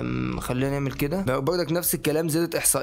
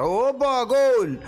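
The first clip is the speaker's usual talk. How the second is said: high, loud, drawn-out goal cry; goal shout; 7 semitones higher, 4 dB louder, much slower than usual. Real, not acted. Gamer scoring in FIFA, a long excited goal yell. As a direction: yell it like the ball just hit the net.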